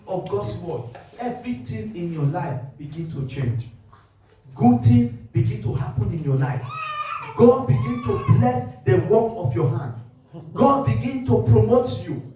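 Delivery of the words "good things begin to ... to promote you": fiery preaching. Real, not acted. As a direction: preach it out with full force. Preaching at full force.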